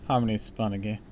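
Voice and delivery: plain reading voice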